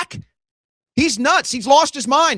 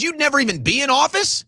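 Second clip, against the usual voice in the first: ordinary delivery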